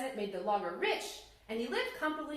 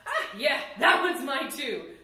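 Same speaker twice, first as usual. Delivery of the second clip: greedy smug claim; 2 semitones higher, 8 dB louder than usual. Acted. Greedy logger claims more.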